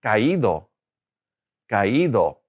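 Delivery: "caído" said twice, í stressed each time; careful model of "caído", falling after the stressed í; stress on the accented í. Shown as a demonstration. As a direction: lean on the í in "caído". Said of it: "that stress goes on that I".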